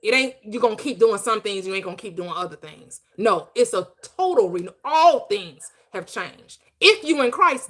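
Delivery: loud, slow, hammering preacher's declaration; preaching at full force. Acted. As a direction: pound the point home like a sermon.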